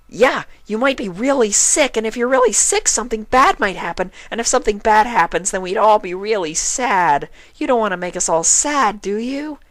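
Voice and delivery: high, loud, fast bubbly chain of worries; Pinkie Pie rambling. A narrator's reading. Pinkie persuading Spike, high and breathless. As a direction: rattle it off like an eager kid.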